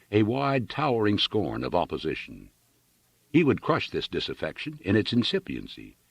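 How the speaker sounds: usual speaking voice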